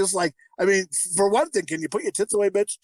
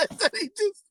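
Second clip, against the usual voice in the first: high, strained voice through laughter; laughing hard; 14 semitones higher than usual. Real, not acted. Amazed this is a kid movie, cracking up. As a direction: squeeze the words out between laughs. Cracking up while retelling film.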